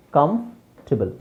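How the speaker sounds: ಕಂಫರ್ಟಬಲ್ slowly split as kamf, tibal; correct pronunciation. Shown as a demonstration. Teacher modelling silent O and R, correct pronunciation. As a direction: pause after kamf in ಕಂಫರ್ಟಬಲ್. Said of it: "ಓ ಮತ್ತು ಆರ್ ಎರಡೂ silent".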